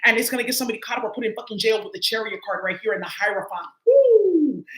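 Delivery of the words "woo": high, loud whoop of glee; excited whoop. Real, not acted. Tarot reader whoops at the cards she pulled, excited. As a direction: whoop it out like a big reveal.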